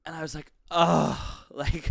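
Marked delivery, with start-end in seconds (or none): 0.7-1.2 loud, higher dreading groan; exasperated groan. Retold or imitated. Tired passenger dreading chat with the driver, exasperated groan. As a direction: groan out loud at unwanted small talk.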